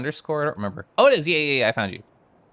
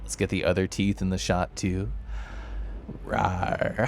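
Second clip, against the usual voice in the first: much softer, lower, hushed close-mic voice; ASMR softness; 9 semitones lower, 6 dB quieter than usual. Pretend. Soft ASMR voice for the viewer who redeemed it. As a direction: hush it down like an ASMR clip. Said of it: "for redeeming A S M R".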